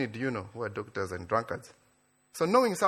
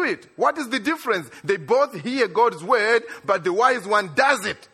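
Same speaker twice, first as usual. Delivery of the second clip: full-force preaching; 7 semitones higher, 5 dB louder than usual. Acted.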